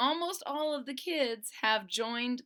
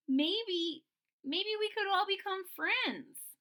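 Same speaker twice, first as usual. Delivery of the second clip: hopeful Stego; 10 semitones higher, slower than usual. Acted. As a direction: wonder aloud gently. Friend Stego voiced.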